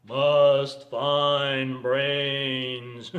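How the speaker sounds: long, droning zombie moan, loud. Acted. Driving dead hunting for brains, zombie drone. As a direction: drag every word out like the hungry undead. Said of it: "People drive like zombies".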